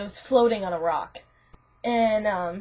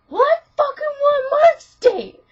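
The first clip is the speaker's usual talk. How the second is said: very high, loud hammed-up yell; mock angry shout; 9 dB louder than usual. Rob outdoes his friends over dates, mock angry shout. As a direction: top everyone in a mock squabble. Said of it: "he was all angry".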